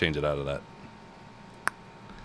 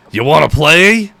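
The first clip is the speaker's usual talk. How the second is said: excited, higher voice of an eager pet; playful dragon voice; 5 semitones higher, 5 dB louder than usual. Acted. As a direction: coax like a bouncy pet dragon. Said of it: "he's gonna be like".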